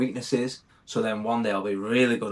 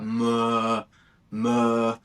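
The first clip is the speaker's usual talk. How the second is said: imitating failed speech. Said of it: "I couldn't say mowing".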